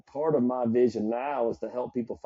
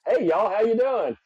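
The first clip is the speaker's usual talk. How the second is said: put-on Southern accent; 10 semitones higher, 6 dB louder than usual. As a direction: greet them in a big Southern accent. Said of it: "I always love my Southern accent".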